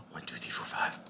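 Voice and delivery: eerie whispered counting; creepy whisper. Pretend. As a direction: count in a spooky hushed voice. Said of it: "That was a creepy voice".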